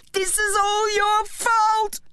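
high, slow, furious scream. Acted. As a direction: scream it out in rage. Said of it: "he screamed".

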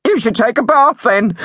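high, loud prank-call voice; prank caller. A narrator's reading. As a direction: deliver the punchline high and loud. Prank punchline to Mister Stinky.

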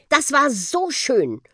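slow, high, delighted praise; drawn-out, admiring thanks to a friend; heartfelt praise. A narrator's reading. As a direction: linger on it appreciatively. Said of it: "lobte er den Buschi".

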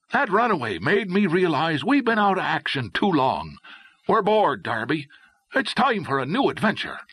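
high, enthusiastic adventurer voice; eager young Irishman. A narrator's reading. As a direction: urge a friend on, restless for adventure.

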